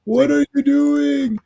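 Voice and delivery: high, drawn-out mock wail; horrified protest. Comic fan voicing a character's appalled protest, mock horror. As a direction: stretch it out in hammed-up dismay.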